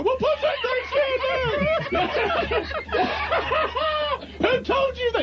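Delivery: high indignant boss voice with a laugh; Joe impression. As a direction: squeal it high and outraged. Boss voiced in retold prank.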